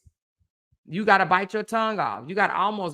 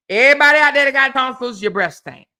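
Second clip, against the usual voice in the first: fast, much higher and louder call; 6 semitones higher, 8 dB louder, faster than usual. Real, not acted. Calling out to everyone watching with tonsils, loud. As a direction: shout it out to the whole audience.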